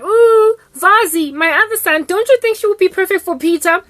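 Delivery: high, loud gushing mother; mother compliments the girl, high and loud; cooing mom. Retold or imitated. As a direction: translate her gush, still high and loud. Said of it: "she's like".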